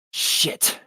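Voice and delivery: whispered curse through the teeth; hissed. Acted. Dwight cursing to himself after a fall, hissed. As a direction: spit it out in a sharp whisper. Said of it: "Dwight hissed aloud to himself".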